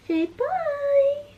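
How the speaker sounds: mother cooing to her sleeping newborn. Real, not acted. Mother prompting her sleeping baby.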